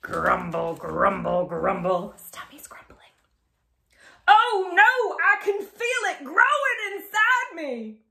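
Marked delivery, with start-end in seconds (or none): 2.1-3.0 hushed whispered aside. A narrator's reading. Narrator whispers to children about the grumbling tummy. 4.3-8.0 high, alarmed cry. Acted. Crocodile panics feeling the seed grow, high alarmed voice.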